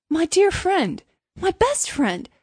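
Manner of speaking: high, effusive little Hans; crying out. A narrator's reading. Hans protests his friendship to the miller, high effusive cry. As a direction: cry it out, pitched well up. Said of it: "cried little Hans".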